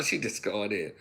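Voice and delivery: normal talking voice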